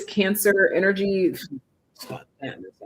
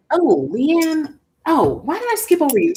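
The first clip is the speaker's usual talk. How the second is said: startled realisation; 10 semitones higher, 5 dB louder than usual. Real, not acted. Realising she skipped someone.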